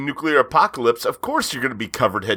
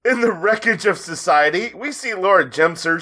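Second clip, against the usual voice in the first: laughing; 7 semitones higher than usual. Real, not acted. Laughing while narrating.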